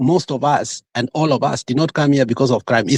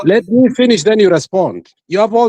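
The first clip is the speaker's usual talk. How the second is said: raised insistence; 4 semitones higher than usual. Real, not acted. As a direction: cut through his interruptions. Insists on finishing over interruptions.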